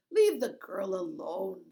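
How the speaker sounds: loud, dismissive guard voice; gruff guard. A narrator's reading. Bearded guard argues with the other, loud dismissive. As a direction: wave the other off.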